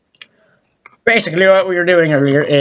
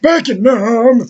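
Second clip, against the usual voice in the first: mock war veteran; 6 semitones higher, much slower than usual. Veteran parody.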